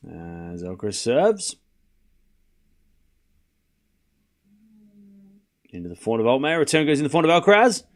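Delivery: low, quiet tennis commentary; live play-by-play. Acted. Calling the tennis rally for stream viewers, hushed commentator. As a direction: call each shot like a TV commentator.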